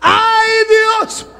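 high, loud crying shout; cry of terror. Retold or imitated. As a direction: cry out to God facing death. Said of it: "cuando sintió la muerte, dijo". (la profesora grita aterrada ante la muerte)

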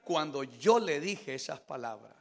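low, very soft, subdued testimony; testimonio íntimo. Retold or imitated. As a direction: recount a private prayer in a hushed, low voice. Subdued testimony of talking with God. (testimonio en voz baja, grave y recogida)

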